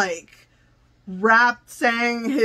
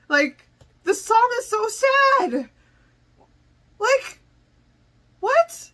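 much higher, rising disbelief; overwhelmed exclaim; 11 semitones higher than usual. Real, not acted. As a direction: cry out at how sad it is. Reacting emotionally to sad song.

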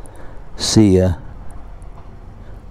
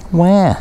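high fake baby wail; a short hammed-up boo-hoo; mock crying wail; 8 semitones higher than usual. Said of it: "I'm done crying".

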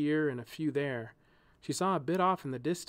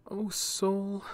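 higher, tender heartfelt sigh; 7 semitones higher than usual. A narrator's reading. She pities her crying friend, tender and heartfelt. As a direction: speak straight from the heart. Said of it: "as if it had been spoken from her heart itself".

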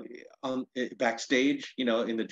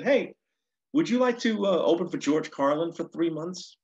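lightly imitated agent, pitched up; agent's phone pitch; 7 semitones higher, 4 dB louder than usual. Agent calls comic with an offer, lightly voiced. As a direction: retell a caller's upbeat offer. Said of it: "he called up one day and said".

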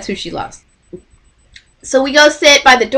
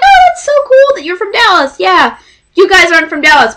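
bubbly excitement; 8 semitones higher, 4 dB louder than usual. Retold or imitated. Quoting the group.

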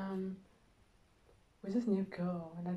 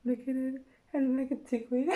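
ordinary delivery

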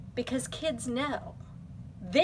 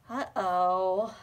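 plain reading voice

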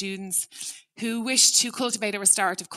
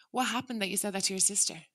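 softer, even, unhurried question; calm curious ask; 5 dB quieter, faster than usual. Shown as a demonstration. Parent calmly asking a child about the sister, curious. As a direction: ask gently, wanting to understand.

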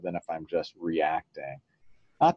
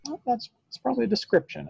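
much higher, quick student voice; student answer; faster than usual. Pretend. A student answers the mindfulness teacher in a high, quick voice. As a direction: pipe up fast like a kid working it out.

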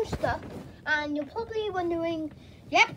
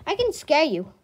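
ordinary delivery

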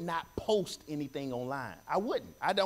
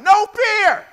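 shouted at full voice; yelled; 13 semitones higher, 15 dB louder than usual. Shown as a demonstration. Pastor leading the church in yelling no fear. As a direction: yell it out loud to lead the church. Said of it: "just yell it out".

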